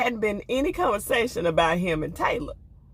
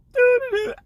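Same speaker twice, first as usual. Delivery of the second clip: mock humming; 5 dB louder than usual. Mimics oblivious humming.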